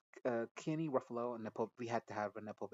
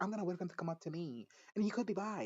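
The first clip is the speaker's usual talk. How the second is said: mock naive girl; 7 semitones higher, faster than usual. Mocking the girl's naive line.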